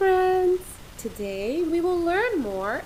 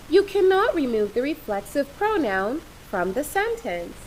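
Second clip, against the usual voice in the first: normal talking voice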